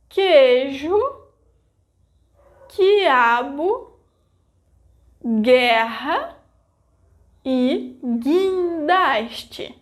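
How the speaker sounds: queijo, quiabo, guerra, guindaste with silent U; Portuguese example words. Shown as a demonstration. Teacher modeling Portuguese words for learners, carefully. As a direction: say the U as silent after Q and G. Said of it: "I didn't pronounce the vogal U".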